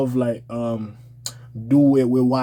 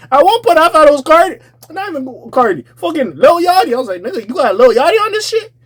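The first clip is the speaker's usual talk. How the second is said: high, loud incredulous rant; excited rant; 12 semitones higher, 5 dB louder than usual. Real, not acted. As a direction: rant in outrage at the voice. Mocking [name]'s voice.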